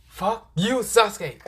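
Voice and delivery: high, strained comic outburst; mock angry curse. Naruto cursing Sasuke over the teasing, mock angry. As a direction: curse him in a high, squeezed voice.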